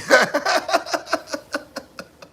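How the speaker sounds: very high, loud cackling laugh. Real, not acted. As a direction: let out a long, high burst of laughter. Own burst of laughter.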